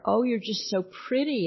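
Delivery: high, cooing praise with a falling end; gushing parent. Retold or imitated. Parent fawning over daughter's looks, high cooing praise. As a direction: coo it like a doting parent. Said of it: "If a parent says to the daughter".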